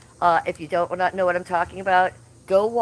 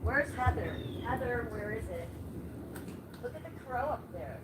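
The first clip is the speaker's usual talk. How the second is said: higher, much softer asking; soft higher calling; 4 semitones higher, 12 dB quieter than usual. Real, not acted. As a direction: quietly call for Heather. Calling softly from inside.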